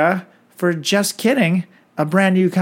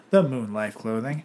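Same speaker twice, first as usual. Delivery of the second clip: announcer read; 5 semitones lower, 5 dB quieter than usual. Acted. Sponsor name echoed back slowly.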